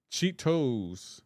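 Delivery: drawn-out Cheetos, CHEE-TOES with a clear T; every syllable pronounced. Shown as a demonstration. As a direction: say "Cheetos" slowly in two full syllables. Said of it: "I pronounce it how it's spelled".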